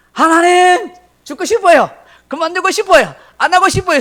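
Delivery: high, loud whiny cry to God; whiny outburst. Retold or imitated. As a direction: wail it like a sulking child. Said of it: "요나처럼 그렇게 말하고". (하나님께 떼쓰는 요나처럼, 높고 크게 징징대는 목소리)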